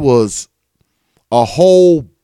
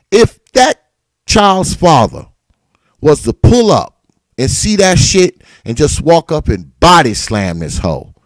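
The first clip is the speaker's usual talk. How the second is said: normal talking voice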